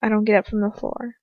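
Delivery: ordinary delivery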